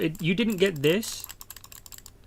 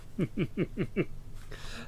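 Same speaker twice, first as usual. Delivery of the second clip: quiet giggle; 2 semitones higher, 8 dB quieter than usual. Real, not acted. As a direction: giggle softly at your own joke. Giggles at his own joke.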